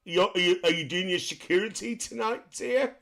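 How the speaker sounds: high, loud mock English accent. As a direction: greet someone in a put-on English accent. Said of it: "I like the English accent".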